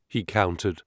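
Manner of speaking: plain reading voice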